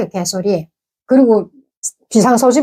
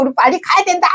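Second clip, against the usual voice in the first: high, urgent retold call to hurry; hurried alarm in a raised pitch; urgent urging; 9 semitones higher, faster than usual. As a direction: press the group to get moving, pitched up. Urging the group to flee.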